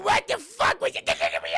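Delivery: high, loud mock shout; hammed-up high-pitched zapped yelp; shocked Cartman yelp. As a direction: yell it as if being electrocuted. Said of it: "Every, every time he swears".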